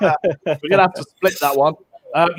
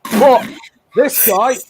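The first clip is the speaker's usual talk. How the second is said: higher-pitched, hammed-up Irish accent; attempted Irish accent; 4 semitones higher than usual. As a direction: have a clumsy go at a Belfast accent. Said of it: "I can't do an Irish accent".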